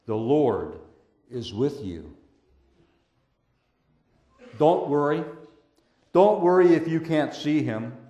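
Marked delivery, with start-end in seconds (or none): 0.0-2.1 the Lord is with you, said softly and low. Real, not acted. Pastor reassuring the congregation, soft and low. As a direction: drop to a hush, intimate. Same phrase dropped soft.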